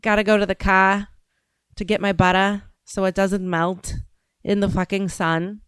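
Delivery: Boston accent with dropped R's. Shown as a demonstration. Showing the chat how Boston people talk, Boston accent. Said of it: "what people from, like, Boston sound like".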